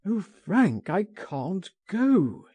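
high, slowing cry of refusal; distressed cry. A narrator's reading. Oscar refuses his friend's escape plan, crying out. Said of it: "he cried".

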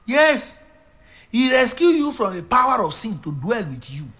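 high, full preacher proclamation; preaching. Acted. Preacher proclaiming the risen Christ to his flock. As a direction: declare it triumphantly.

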